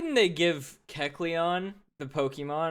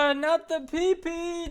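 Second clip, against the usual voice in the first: a shrill, much higher hammed-up squeal of horror; mock panicked shriek. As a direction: shriek it like a cartoon damsel in mock terror. Mock panic over pee-pee joke.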